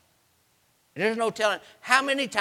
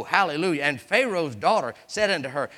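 ordinary talk, nothing marked

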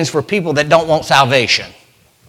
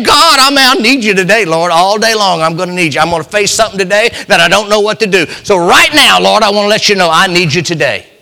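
higher, louder preaching; fervent preaching; 5 semitones higher, 4 dB louder than usual. Acted. Preacher urging the congregation to seek God early, fervent. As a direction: lift the church with fervor.

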